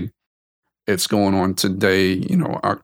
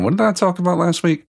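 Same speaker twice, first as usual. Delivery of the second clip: higher-pitched self-quote; acting out own wondering; 10 semitones higher, faster than usual. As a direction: play yourself puzzling aloud, pitched well up. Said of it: "So if you hear me going".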